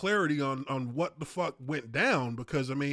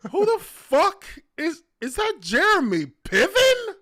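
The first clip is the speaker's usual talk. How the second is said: shocked disbelief; 12 semitones higher, 7 dB louder than usual. Retold or imitated. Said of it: "and I'm like".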